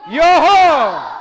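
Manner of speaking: loud high yo-ho cry; superhero shout. Acted. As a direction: belt out a heroic yell. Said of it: "Three, two, one".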